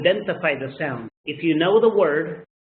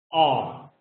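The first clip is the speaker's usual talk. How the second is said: ordinary talk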